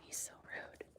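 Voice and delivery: unvoiced whispered aside. Real, not acted. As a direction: mutter it under the breath. Aside about the animal.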